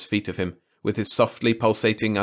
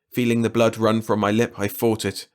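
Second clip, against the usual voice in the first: plain reading voice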